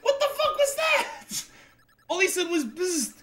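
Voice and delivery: high incredulous outburst; baffled. Real, not acted. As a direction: ask it high, laughing in disbelief. Baffled by the runner's bzzt.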